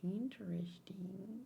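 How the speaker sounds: a slow, breathy whisper of interest; murmured aside while watching. Real, not acted. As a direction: hush it, musing to yourself. Reacting to scene in a whisper.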